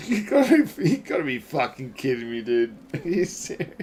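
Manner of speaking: incredulous, breaking into laughter; laughing disbelief. Real, not acted. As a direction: crack up while protesting in disbelief. Hears the pronunciation, cracks up.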